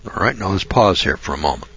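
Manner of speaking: usual speaking voice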